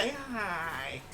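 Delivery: usual speaking voice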